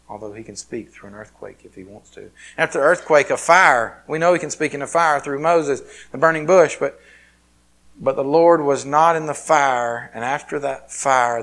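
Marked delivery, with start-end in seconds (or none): 2.6-11.4 loud, rising preacher cadence; preaching at full force. Acted. Pastor retelling Elijah's story to the church, loud and fervent. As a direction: build the passage with fervor.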